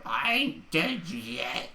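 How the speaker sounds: put-on slow, creaky old woman voice; old crone voice. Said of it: "the emphasis is on yet".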